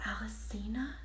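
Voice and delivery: breathless whispered gasp of the name; the name caught in a shocked breath. Acted. Amber realizes the lady is Alicina, whispered gasp. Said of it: "she gasped".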